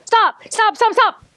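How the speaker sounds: high, urgent repeated calls; urgent call to stop. Real, not acted.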